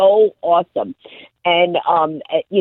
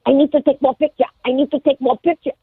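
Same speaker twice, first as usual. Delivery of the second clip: higher, eager imitation of her Japanese-accented English; mock Japanese accent; 7 semitones higher than usual. Woman begging to keep taking photos, mock Japanese accent. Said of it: "And she goes".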